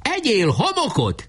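high, drawn-out taunt; heated battle cry. A narrator's reading. Heated Barabás hurls bombs at Pásztor, high drawn-out cry. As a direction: cry it out carried away by the fight. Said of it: "kiáltott nekihevülten Barabás".

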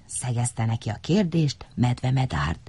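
ordinary delivery

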